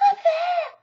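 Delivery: high, loud anxious squeak; frightened Piglet. A narrator's reading. Piglet showing Christopher Robin where the monster is, alarmed. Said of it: "said Piglet, waving his paw".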